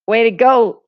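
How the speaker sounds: ordinary delivery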